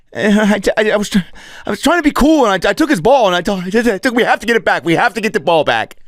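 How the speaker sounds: put-on high, flustered boy voice; kid voice. The main kid confessing to his friends, flustered high voice. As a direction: stammer like a nervous kid.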